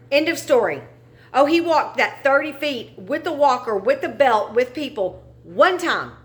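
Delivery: high, loud angry outburst; furious rant. Real, not acted. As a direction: pitch up and hammer each short verdict. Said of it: "it angers the shit out of me".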